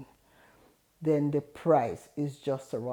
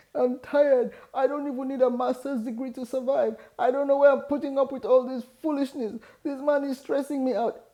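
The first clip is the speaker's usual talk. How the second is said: higher, fast, upset pleading; tearful outburst retold; 9 semitones higher than usual. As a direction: spill it out close to tears. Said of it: "I'm like".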